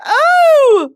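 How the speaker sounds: squealing. Pretend. Continued squealing at actor.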